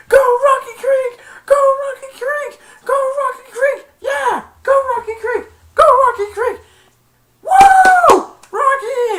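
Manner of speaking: very high, slow shouted team chant; screaming cheer. Retold or imitated. Tony Hawk cheering Rocky Creek, screamed chant. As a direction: cheer like a crazed fan.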